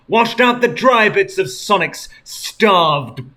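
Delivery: hammed-up dramatic narration; dramatic story reading. A narrator's reading. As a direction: read the gore like a horror epic. Dramatic gory fanfic narration.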